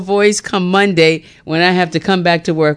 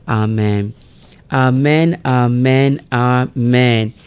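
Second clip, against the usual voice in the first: prayer; 5 semitones lower than usual. Real, not acted. Radio host leading listeners in the Lord's Prayer, hushed and reverent. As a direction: recite it reverently, unhurried.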